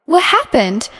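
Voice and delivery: mildly startled, pitch lifted then falling; puzzled question. A narrator's reading. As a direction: ask it with a touch of alarm. Greg asks the silent room.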